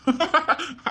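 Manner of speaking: high, crowing villain laugh; gloating laugh. Acted. Schemer gloats to accomplice, villainous laugh. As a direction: cackle over the catch.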